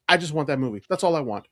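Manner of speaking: ordinary delivery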